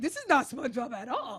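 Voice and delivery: loud, incredulous protest; appalled disbelief. Retold or imitated. Telling friends how viewers reacted to morbid SpongeBob, appalled. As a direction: protest it loudly, half laughing.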